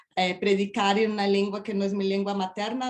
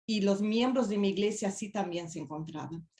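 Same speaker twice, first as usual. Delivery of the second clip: ordinary delivery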